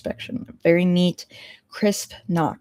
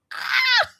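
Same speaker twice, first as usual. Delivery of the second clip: sudden high-pitched squeal. Real, not acted. Player squealing at the accountant's name, sudden and high. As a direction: squeal like you can't contain it.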